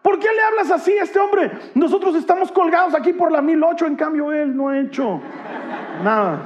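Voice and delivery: high-pitched indignant outburst; acted angry scolding of a fellow criminal; furious rebuke. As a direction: protest indignantly, pitched well above normal. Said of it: "se pone furioso y le dice".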